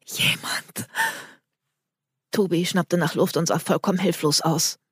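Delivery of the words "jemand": frightened breathy whisper. Acted. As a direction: breathe the word out in fear. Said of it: "keuchte sie".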